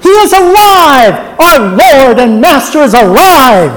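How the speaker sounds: high, loud joyful shouts; yelling with joy. Retold or imitated. Mary running to the disciples, yelling with joy. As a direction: cry out the news, overjoyed. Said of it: "Mary kept yelling with joy".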